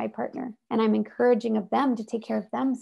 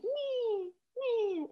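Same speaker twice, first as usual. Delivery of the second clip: much higher, soft imitated baby whine; baby whimper; 12 semitones higher, 9 dB quieter than usual. Imitating a baby crying for help in the room, a high soft whine. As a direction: squeak like a fussing baby.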